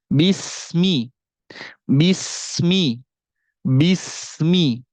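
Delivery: "Bismi" with a clear kasra on bi and a hissing s; correct bismi. Shown as a demonstration. Teacher showing students the right "Bismi", clear kasra on bi. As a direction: say "Bismi" crisply, bi fully voiced, s flowing. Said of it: "Yang benar kayak gimana?".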